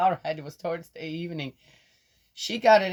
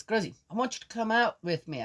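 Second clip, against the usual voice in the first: granddaughter's voice; 8 semitones higher, faster than usual. Retold or imitated. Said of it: "she says".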